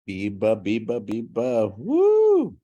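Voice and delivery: rally the room with a cheer; excited cheer. Real, not acted. Host cheers the audience before introducing the next video, loud whoop.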